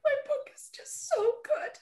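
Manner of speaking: high, soft, laughing through tears; teary squeak. Retold or imitated. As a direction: squeak it out while sobbing. Crying answer to husband.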